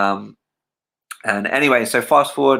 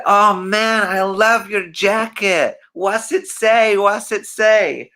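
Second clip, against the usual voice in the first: Michael imitation; 9 semitones higher, 3 dB louder, much slower than usual. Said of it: "he said".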